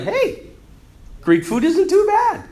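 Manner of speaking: high-pitched, acted discovery; pleasant surprise. Conquered people taste the food, pleasantly surprised. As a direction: sound won over despite yourself, voice lifted high.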